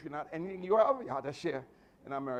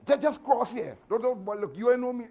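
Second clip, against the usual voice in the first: loud, higher mock challenge to fight; fight talk; 5 semitones higher, 11 dB louder than usual. A braggart daring a man to cross, loud mock threat. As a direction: bark it like a bluffing tough guy. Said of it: "threat talk and fight talk".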